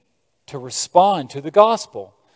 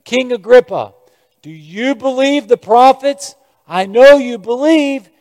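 bold appeal; 7 semitones higher, 5 dB louder than usual. Acted. Paul appealing boldly to king.

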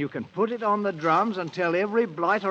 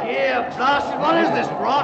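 higher, louder indignant bark; king outraged at the turning crowd; angry outburst; 7 semitones higher, 5 dB louder than usual. Acted. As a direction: demand an answer, outraged. King sees uprising against him.

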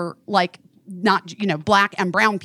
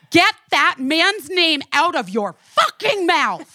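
higher, slower retold angry yell; 7 semitones higher, slower than usual. Scolding a bigot at the bar, retold angry yell. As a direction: fire it back at him, slow and forceful. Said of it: "this is when I yelled".

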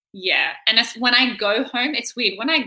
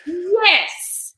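high, loud relieved yes; mock eager agreement; 10 semitones higher, 4 dB louder than usual. Finally understood by the waitress, eager relieved yes. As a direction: burst out in relief. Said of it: "And I was like".

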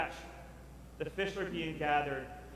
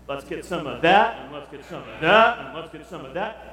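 loud, eager grab for more food; greedy buffet guy; 10 dB louder than usual. Retold or imitated. Buffet glutton going for more plates, loud and eager. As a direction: pile up the plate with gusto.

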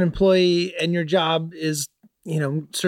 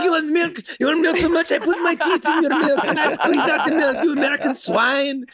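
mock French worker; 12 semitones higher than usual. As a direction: ham up a snarling French cafeteria lady. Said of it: "She's like".

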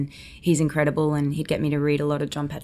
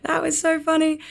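much higher, thrilled mock exclamation; delighted retelling; 13 semitones higher than usual. DiCaprio and Scorsese cracking up at the slap, higher delighted mock exclamation. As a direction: squeal it like laughing directors. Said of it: "they were both laughing".

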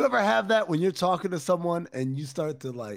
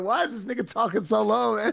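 higher-pitched acted bafflement; mock bafflement; 7 semitones higher than usual. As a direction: act out a puzzled complaint. Acting out his baffled complaint.

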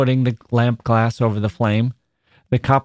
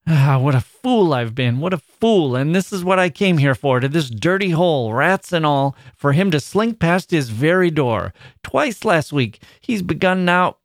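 high-pitched bitter self-reproach; bitter outburst; 6 semitones higher than usual. A narrator's reading. Wife lamenting the dirty home and absent husband, bitter. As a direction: rail at your lot and at him.

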